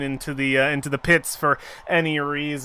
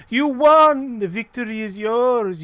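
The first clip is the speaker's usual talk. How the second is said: high, lilting triumphant cry; exultant proclamation; 7 semitones higher, 4 dB louder than usual. Acted. Arena mistress congratulates the reluctant winner, high and grand. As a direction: proclaim it brightly to the victor.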